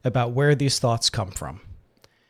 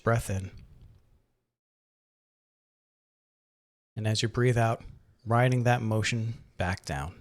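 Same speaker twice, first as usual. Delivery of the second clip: calm, unhurried meditation guide, gradually softening; guided meditation; 3 dB quieter than usual. Acted. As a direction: ease the listener into relaxation, leaving long pauses. Leads a breathing meditation.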